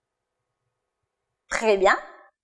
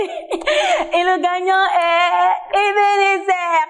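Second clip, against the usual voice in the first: game-show reveal of the winner, Ebenezer; laughing winner announcement; 7 semitones higher, much slower than usual. Real, not acted. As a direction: announce the champion with a laughing shout.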